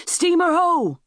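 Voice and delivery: very high, ringing shout; lookout's shout. Acted. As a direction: call it down from the masthead. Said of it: "a shout came from the lookout".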